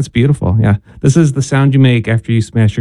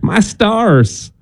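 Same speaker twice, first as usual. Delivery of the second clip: Southern accent; 7 semitones higher than usual. Shown as a demonstration. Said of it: "you would only say that with a Southern accent".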